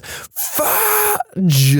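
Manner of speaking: sudden high yelp of fright; startled yell. Retold or imitated. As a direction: blurt it out in a high, startled cry. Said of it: "gets startled and yells".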